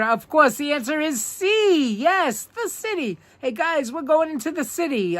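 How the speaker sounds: high, light voice inviting friends; playful sing-song. Acted. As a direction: lift it up playfully.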